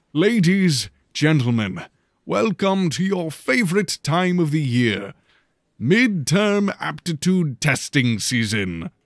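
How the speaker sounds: headmaster's address. A narrator's reading. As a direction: project it to a packed hall like a showman headmaster. Headmaster addresses the auditorium crowd.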